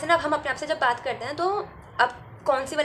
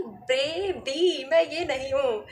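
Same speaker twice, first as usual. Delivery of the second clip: drawn-out, hammed-up baby, rising to a laughing outburst; a touch of theatrical shock with a laugh at the end; mock dramatic self-talk; 2 semitones higher, 4 dB louder, much slower than usual. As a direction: play up your own disbelief, half laughing. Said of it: "मुझे लगता है कि".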